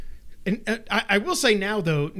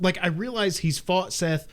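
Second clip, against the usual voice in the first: ordinary talk, nothing marked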